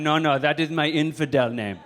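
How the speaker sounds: mock Middle Eastern accent. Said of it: "I'll even fake a Middle Eastern accent".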